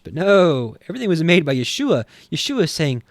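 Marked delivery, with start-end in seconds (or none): none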